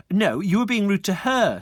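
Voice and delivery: huffy schoolgirl; offended triplet. Acted. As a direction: scold him primly. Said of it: "said the triplet".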